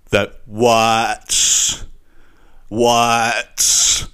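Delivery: stretched, puzzled "what?" in an odd voice; mock drawn-out what. Mocking the contestant's weird "what".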